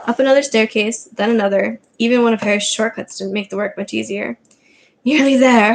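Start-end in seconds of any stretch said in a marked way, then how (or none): 5.0-5.7 panting. Acted. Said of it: "Harry panted".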